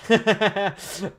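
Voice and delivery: short skeptical laugh; amused laugh. Real, not acted. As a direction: laugh off the rumor. Laughs at rumor.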